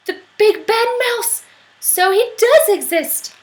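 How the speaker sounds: high, loud astonished cry; child shocked the mouse exists; amazed child. Acted. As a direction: gasp it out in wonder.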